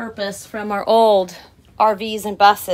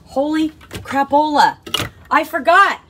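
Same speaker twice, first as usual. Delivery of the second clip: excited outburst; 6 semitones higher, 5 dB louder than usual. Real, not acted. Suddenly remembers extra cabinet.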